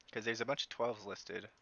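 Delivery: usual speaking voice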